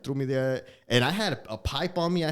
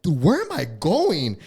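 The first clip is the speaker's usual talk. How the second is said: drawn-out, higher, louder retold question; bewildered; 4 semitones higher, 5 dB louder, slower than usual. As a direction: stretch it out in mock panic. Acting out alarm in paddy wagon.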